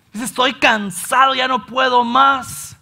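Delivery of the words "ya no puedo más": normal talking voice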